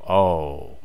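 ordinary talk

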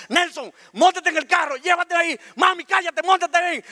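high-pitched, urgent commands; urgent shouted orders. Retold or imitated. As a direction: take control, shouting everyone into the car. Boy takes control in emergency. (órdenes gritadas con urgencia)

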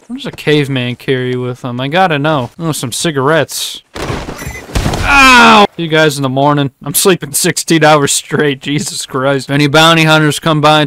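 ordinary delivery

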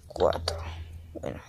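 susurro sin voz, para sí; susurro concentrado. Real, not acted. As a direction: murmura mientras trabaja con cuidado. Susurra mientras coloca bloques. (susurro sin voz mientras coloca los bloques)

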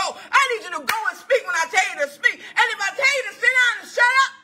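forceful, rising pulpit exhortation; urgent preacher speaking as God; preaching God's command. Acted.

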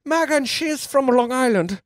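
high-pitched comic Irish lilt; put-on Irish accent. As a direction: sketch her in a hammed-up Irish accent. Plays on an Irish surname.

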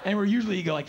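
ordinary talk, nothing marked

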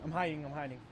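soft hushed words while hiding; whispering. Real, not acted. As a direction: hush down to a whisper. Hiding from monster.

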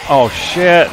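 high, loud startled yell; yelling while running away. Real, not acted. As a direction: shout it in panic while fleeing. Fleeing the puker in game.

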